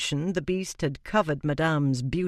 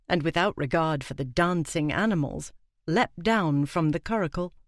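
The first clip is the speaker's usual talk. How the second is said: plain reading voice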